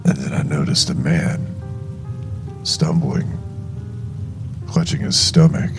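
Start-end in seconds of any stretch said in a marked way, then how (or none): none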